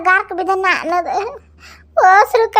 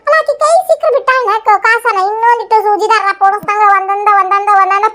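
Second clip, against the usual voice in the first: louder, higher fuming complaint; angry rant; 5 semitones higher, 5 dB louder than usual. Acted. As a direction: rail at him. (ಸಿಟ್ಟಿನಿಂದ ಜೋರಾಗಿ)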